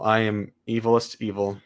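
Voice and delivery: ordinary delivery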